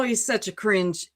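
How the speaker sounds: ordinary talk, nothing marked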